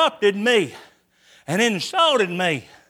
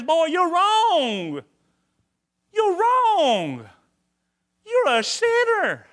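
higher, slow, insistent rebuke; grace confronting; 7 semitones higher, much slower than usual. Retold or imitated. Grace confronting the sinner in the pew, rising high. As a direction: call him out, eyeball to eyeball.

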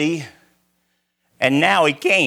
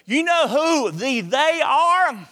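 ordinary talk, nothing marked

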